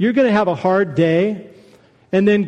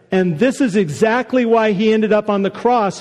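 ordinary delivery

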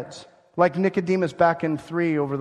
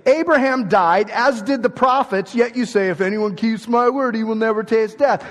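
loud, higher, scornful challenge; mocking accusers; 6 semitones higher, 6 dB louder than usual. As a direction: throw it back at him in triumph.

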